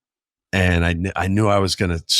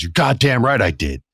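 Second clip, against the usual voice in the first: higher-pitched acted comeback; heated retort; 6 semitones higher than usual. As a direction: throw it right back at him, retold. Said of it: "got right back in my face".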